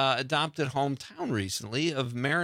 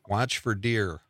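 lightly imitated Wisconsin accent; a mild mock Wisconsin accent; 4 semitones lower, slower than usual. Host quotes guest's video line back to him, lightly imitated Wisconsin accent. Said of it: "in your awesome Wisconsin accent".

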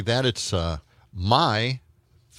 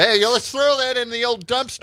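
excited shout; 14 semitones higher than usual. Pretend. Joking about tossing news in dumpster.

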